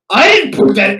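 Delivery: loud, high retold outburst; mock freak-out. Boyfriend denying he planted the ring, freaking out. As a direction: imitate him losing it, voice jumping up. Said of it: "he fucking lost it".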